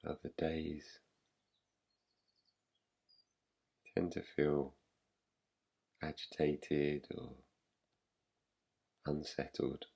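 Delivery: soft, slightly low voice with long pauses; guided meditation. Real, not acted. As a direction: guide them gently into rest, leaving long silences. Leads a yoga nidra practice.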